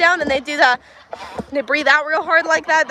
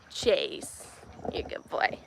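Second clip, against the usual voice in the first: whispered praise; 10 semitones lower, 14 dB quieter, slower than usual. Real, not acted. Praising the dog.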